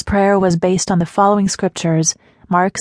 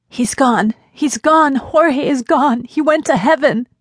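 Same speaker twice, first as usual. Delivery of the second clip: high, agitated frantic cry; 7 semitones higher, slower than usual. A narrator's reading. Caller phoning with terrible news, frantic. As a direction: exclaim it in a frantic rush of distress. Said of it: "exclaimed the frantic caller".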